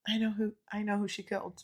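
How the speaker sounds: very soft retold thought; hushed realisation. Listener recalls him popping up, hushed realisation. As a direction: say it under your breath. Retells own private reaction.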